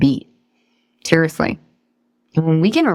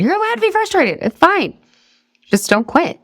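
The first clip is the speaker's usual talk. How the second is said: high reassurance; 11 semitones higher than usual. Acted. Reassuring kids, voice jumps.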